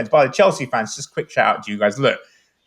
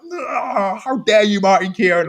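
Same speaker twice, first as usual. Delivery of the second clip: higher, indignant huff; mock outrage; 9 semitones higher, slower than usual. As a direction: play your younger outraged self. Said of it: "I was like".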